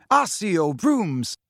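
slow, drawn-out summoning call; spell incantation. A narrator's reading. Twins raising wands together, slow ringing incantation. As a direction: call the brooms to you.

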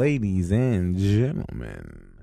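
mock announcer outro. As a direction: wrap the episode like a grave announcer.

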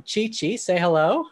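usual speaking voice

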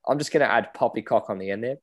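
ordinary talk

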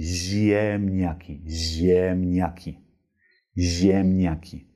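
"ziemniaki" slow, lips spread on the i; slow Polish model. Shown as a demonstration. Teacher modelling Polish word slowly. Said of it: "Wenn du es dir selbst so vorsprichst".

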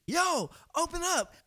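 high, loud shout of yo, open up; yelled call. Retold or imitated. Banging on the gate, calling up to the house, loud yell. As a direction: bellow it up at the window.

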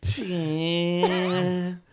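straining to say a word, high and loud; loud, high, struggling comic mispronunciation; Adam Sandler. Acted. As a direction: stretch the first syllable, then blurt it wrong. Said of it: "like fucking Adam Sandler when he's trying".

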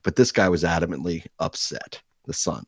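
ordinary talk, nothing marked

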